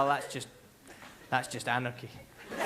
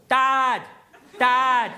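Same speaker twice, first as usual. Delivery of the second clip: DAD called as one loud, higher syllable; 5 semitones higher, 9 dB louder than usual. Shown as a demonstration. Child calling father on business, DAD as one syllable. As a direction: shout DAD in a single businesslike burst. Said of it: "it's just one syllable".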